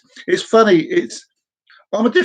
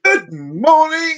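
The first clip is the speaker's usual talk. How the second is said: high, drawn-out teasing greeting; cheery greeting; 11 semitones higher, 3 dB louder, much slower than usual. Acted. Worker winding up the boss, cheery greeting. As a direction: sing it out long and high. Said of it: "I'm gonna go".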